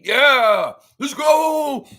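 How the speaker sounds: high, loud hype shout; excited cheer. Real, not acted. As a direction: cheer it out big. Hype cheer to open stream.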